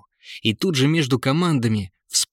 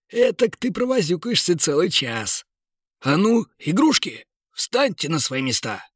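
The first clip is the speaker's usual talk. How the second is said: jolly merchant; 6 semitones higher than usual. Acted. Jolly merchant bosses toys.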